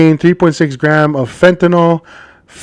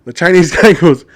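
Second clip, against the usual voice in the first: normal talking voice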